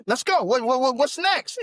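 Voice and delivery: excited. Retold or imitated. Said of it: "could you imagine if both of y'all were like".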